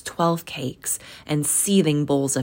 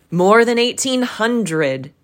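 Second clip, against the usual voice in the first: higher, louder and slow; proud boast; 7 semitones higher, 6 dB louder than usual. A narrator's reading. Ghost boasting of his family, slow and loud. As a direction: savour the huge number. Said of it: "said the ghost".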